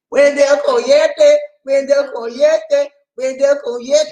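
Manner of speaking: high, slow, loud sing-song calling of a name; drawn-out call. Real, not acted. Greeting a viewer on his channel, high, slow, loud drawn-out call. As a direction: stretch the name out like hollering across a yard.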